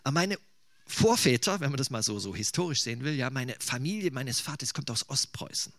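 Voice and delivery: normal talking voice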